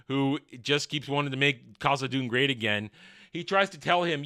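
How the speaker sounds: ordinary delivery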